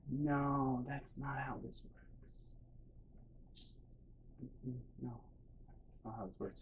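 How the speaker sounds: hushed, slow, fading almost to whisper; quiet disbelief. Retold or imitated. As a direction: trail off helplessly under your breath. Dazed disbelief at mom's logic.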